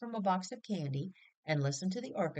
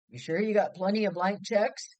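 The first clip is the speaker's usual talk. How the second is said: louder shout to be heard through a train window; shouting through glass; 3 semitones higher, 7 dB louder than usual. Acted. Son calling to Mother through the train window, shouting. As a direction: raise the voice through the storm sash. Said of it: "so he shouted it".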